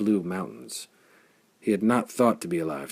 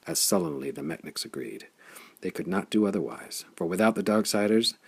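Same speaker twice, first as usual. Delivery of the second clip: plain reading voice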